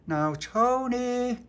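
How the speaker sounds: high, slow, loud call through the letterbox; shouting through letterbox. Acted. Kids calling Tony through the letterbox, high slow shout. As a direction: stretch his name out like calling through a door. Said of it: "shouted through the letterbox".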